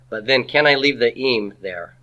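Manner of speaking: ordinary delivery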